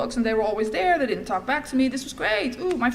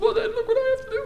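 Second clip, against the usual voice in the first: put-on much higher girlish protest; mock childish protest, much higher; 14 semitones higher, 3 dB louder than usual. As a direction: squeak it like a little girl. Daughter voice to father.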